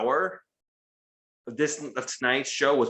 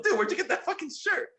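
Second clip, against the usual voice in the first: excited fan greeting. Retold or imitated.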